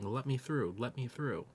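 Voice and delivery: ordinary talk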